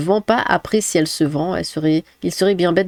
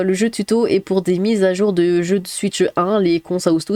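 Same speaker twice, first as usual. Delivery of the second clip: ordinary talk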